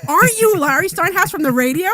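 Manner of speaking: as if spotting a celebrity mid-sentence; starstruck surprise. Retold or imitated. Meeting host recognizes the radio host, loud and startled. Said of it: "she's like".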